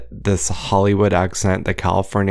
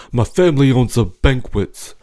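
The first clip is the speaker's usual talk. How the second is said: mock mafia Jersey accent; New Jersey accent; 5 semitones higher than usual. Imitating Real Housewives boasting, New Jersey accent. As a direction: put on a raised Jersey wiseguy accent. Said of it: "Jersey accent is just different".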